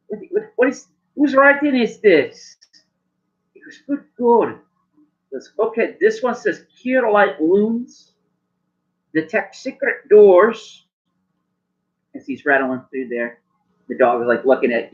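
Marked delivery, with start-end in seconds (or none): 0.1-10.9 high, slow, puzzled gnome voice; fussy gnome shopkeeper. Acted. Gnome shopkeeper squinting at a messy label, high and slow. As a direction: squint at the bad handwriting aloud.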